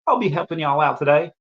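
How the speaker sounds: high-pitched Southern Belle waitress; Southern Belle accent. Retold or imitated. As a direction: imitate a Southern Belle offering service. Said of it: "Southern Belle accent".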